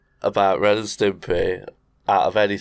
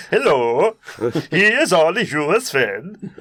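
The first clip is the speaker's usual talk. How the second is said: higher cartoon Sven voice, laughing through; cousin Sven voice; 7 semitones higher than usual. Retelling the cousin's entrance, higher silly voice. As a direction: put on a silly Swedish cousin.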